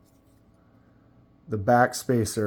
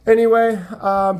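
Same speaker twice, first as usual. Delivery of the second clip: bright high anyway; 11 semitones higher, 6 dB louder than usual. Real, not acted. Laughing reset after joke.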